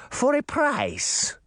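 put-on high, slow sly witch, Russian accent; drawn-out crafty crone with Russian accent. A narrator's reading. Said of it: "in a thick Russian accent".

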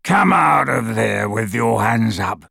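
slow, rasping menacing shout; harsh rasping command, drawn out. A narrator's reading. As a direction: rasp out a slow threat. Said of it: "came a rasping voice through the darkness".

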